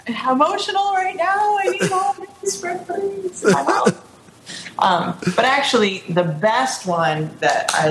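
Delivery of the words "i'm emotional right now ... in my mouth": much higher, hammed-up emotional whine; high mock-dramatic plea for pudding; dramatic emotional self. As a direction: play her own greedy meltdown for laughs. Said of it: "I'm like".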